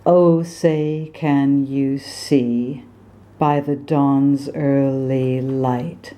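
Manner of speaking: slow American-accented recital. Shown as a demonstration. Coach reciting slowly in American accent. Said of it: "do this best American accent one more time".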